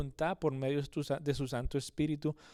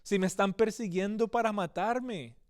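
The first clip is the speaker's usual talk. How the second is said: higher and louder, a pleading, near-tearful cry to God; anguished plea; 5 semitones higher, 5 dB louder than usual. Retold or imitated. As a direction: cry out to God as a hunted man at the end of his rope. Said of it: "llorando, incluso angustiado". (súplica angustiada, más aguda y fuerte, casi llorando)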